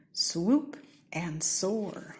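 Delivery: plain reading voice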